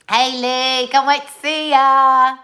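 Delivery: big excited drawn-out greeting. Real, not acted. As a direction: call out to a friend, thrilled.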